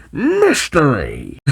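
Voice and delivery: slow, drawn-out dramatic cry; dramatic interjection. Acted. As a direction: announce it like a big reveal. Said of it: "Vector interjects".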